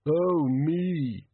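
pained groan of a word; moaning. A narrator's reading. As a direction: moan it out in misery. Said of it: "didn't moan".

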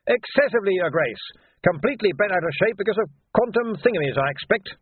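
put-on high-pitched, confident old-school wizard; brisk wizard. Wizard explaining the trouble to His Grace, high and brisk. Voices the wizard beside narration.

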